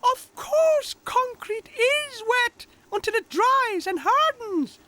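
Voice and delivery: very high, loud, slow cartoon voice; squeaky, emphatic know-it-all; Tech. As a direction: explain the obvious in a piping voice. Narrator voices Tech retorting.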